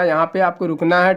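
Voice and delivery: usual speaking voice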